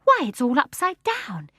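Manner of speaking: a high, amazed exclaim; a surprised girl calling out. Acted. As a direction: Jane marvelling at the sight. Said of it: "exclaimed Jane".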